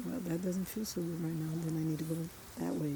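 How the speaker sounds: soft inner reasoning; quiet inner voice. Retold or imitated. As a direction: murmur it like private self-talk. Inner voice noticing a feeling.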